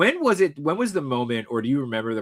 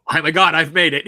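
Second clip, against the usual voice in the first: higher, louder, through a laugh; laughing mock excitement; 5 semitones higher, 10 dB louder than usual. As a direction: gush it while laughing. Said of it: "He realized it when".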